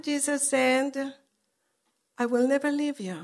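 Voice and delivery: ordinary talk, nothing marked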